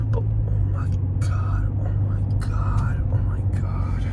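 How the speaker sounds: breathy exasperated whisper. Real, not acted. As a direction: whisper it in disbelief.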